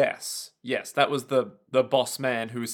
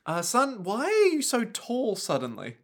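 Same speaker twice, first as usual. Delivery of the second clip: higher, puzzled mother voice; a mother squinting up at her grown son; lightly voiced surprised mum; mock mother; 8 semitones higher than usual. Playing the mother role.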